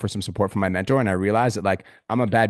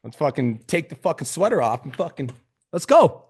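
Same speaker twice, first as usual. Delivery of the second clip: pumped up; 5 semitones higher than usual. Retold or imitated. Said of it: "I'm like".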